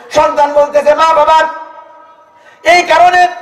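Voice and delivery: climbing high sing-song sermon; chanting praise of the Prophet; fiery preaching. Acted. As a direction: build it up and hold it high. Preaching cadence.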